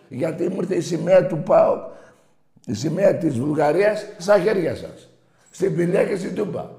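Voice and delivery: a real angry outburst over the flags; angry shout. Real, not acted. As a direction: shout it at the rival fans. Host shouting about rival flags.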